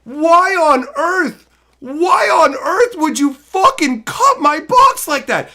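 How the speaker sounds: high, loud, slow outraged yelling; inner scream. Retold or imitated. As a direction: scream it at the guy cutting your box. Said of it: "in my head, I'm screaming".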